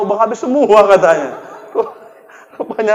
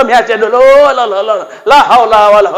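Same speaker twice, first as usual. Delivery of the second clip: ordinary talk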